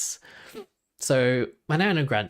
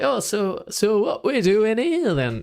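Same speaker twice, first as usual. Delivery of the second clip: put-on higher, slower old northern granddad; granddad's voice; 8 semitones higher, 4 dB louder, slower than usual. Granddad asks the grandchild what's happening. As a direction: play the curious granddad.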